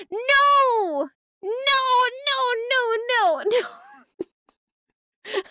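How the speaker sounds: high, loud string of protests; hammed-up panicked refusal; mock horror. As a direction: wail in exaggerated dread. Protesting the turtle-eating stream goal.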